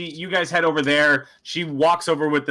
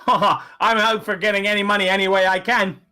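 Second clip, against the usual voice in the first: high, put-on halfling voice; drunk halfling; 7 semitones higher, 4 dB louder than usual. Drunk halfling invited over to play darts, high eager voice. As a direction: pipe up as a tipsy, eager gambler. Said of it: "the halfling is fairly drunk".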